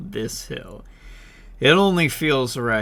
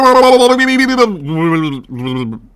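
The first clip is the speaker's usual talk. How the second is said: gasp; 5 semitones higher, 11 dB louder than usual. Real, not acted. Surfacing from the water.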